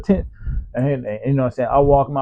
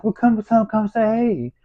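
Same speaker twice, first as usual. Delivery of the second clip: mocking the boy; 10 semitones higher than usual. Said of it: "He's like".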